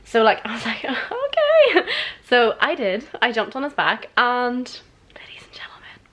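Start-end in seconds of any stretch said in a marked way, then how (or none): 0.8-1.9 very high, drawn-out mock-reluctant okay; high-pitched okay. Agreeing to jump on his back, squeaky retold okay. Acting her hesitant agreement. 5.1-6.0 hushed dramatic whisper. Real, not acted. Storyteller announcing the fall to viewers, dramatic whisper.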